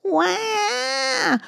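put-on high, piercing baby cry; baby wail. Baby mouse wails in the dark, high piercing cry. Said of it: "cried Baby Mouse".

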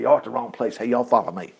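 low, offhand retold call to the soldiers; casual Elisha. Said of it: "He just went out to their leaders and said".

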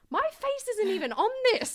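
high, loud incredulous squeak; mock disbelief. Telling friends about fans, a mock indignant squeak. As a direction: protest in mock outrage at the postcard.

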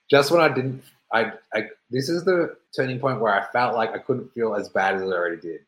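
ordinary talk, nothing marked

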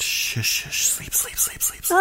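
creepy whispered lullaby repetition; hushing whisper. Acted. Friend with a pillow shushing his buddy to sleep, whispering. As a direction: whisper it softly, over and over, to put them under.

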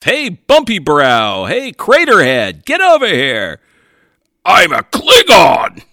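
loud, slow mocking taunts; rude loud barking. Officer insulting Klingons to their faces, loud. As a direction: bark insults across the room.

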